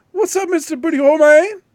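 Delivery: high, loud goofy voice; hammed-up silly shout-out; mock high greeting. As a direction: greet him like a cartoon character. Mocking greeting quoting the clip.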